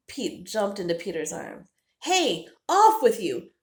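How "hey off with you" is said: loud, slow shooing shout; shouting at a bird. Acted. Peter shouting the hawk away from Pete, loud shooing. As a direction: chase the hawk off with your voice. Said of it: "Peter shouted at the bird".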